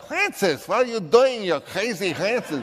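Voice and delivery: mock a Viennese boss teasing Francis; exaggerated high Viennese accent with a laugh. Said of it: "he had a Viennese accent. And he talked like".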